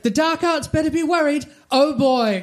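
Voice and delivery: a mock heroic yell. A narrator's reading. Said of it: "Harry began yelling".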